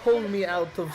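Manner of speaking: put-on halting Eastern European accent. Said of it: "default Eastern European accent".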